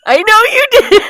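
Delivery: shouting through laughter, high and loud; laughing. Real, not acted. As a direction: burst out laughing mid-line. Teasing banter after deliberate joke.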